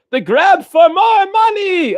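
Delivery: high, slow, hammed-up shout; movie parody voice. Mocking money-grabbing movie sequels, high cartoonish shout. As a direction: stretch it out in a high character voice.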